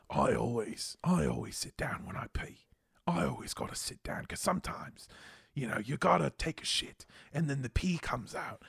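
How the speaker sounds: hushed, half-whispered British impression; soft imitation of a British friend; British accent. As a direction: murmur it confidingly in a British accent. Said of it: "he's got that British accent".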